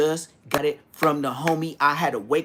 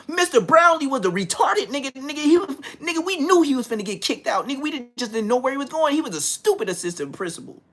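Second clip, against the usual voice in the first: louder, higher, fired-up telling; excited rant; 7 semitones higher, 4 dB louder than usual. Real, not acted. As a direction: ramp up with excitement. Excited rant about principal.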